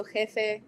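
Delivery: "jefe" said slowly as "hefe", the J breathed like an H; Spanish J as H. Shown as a demonstration. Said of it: "more like an H".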